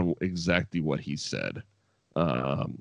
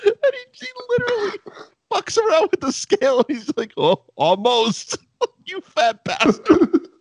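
high, laugh-choked words; real laughter bleeding into the retelling; laughing while talking. Real, not acted. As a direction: talk through your own laughter. Laughing through retelling the scale bit.

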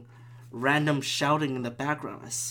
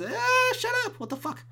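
very high-pitched neighbor imitation; mock angry neighbor. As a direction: squeal it as a caricature of the neighbor. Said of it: "that asshole neighbor who I never hear".

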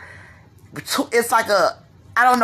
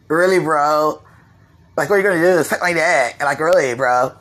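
put-on Chicago slang accent; 9 semitones lower than usual. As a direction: mimic the Chicago guys' bro talk, deep and lazy. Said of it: "But it's that sound".

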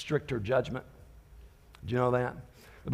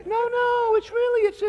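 too-gentle mom; 5 dB louder, slower than usual. Retold or imitated. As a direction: coax the kids too sweetly. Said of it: "talk like this when there's danger".

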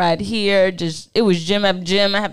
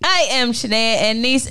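ordinary delivery

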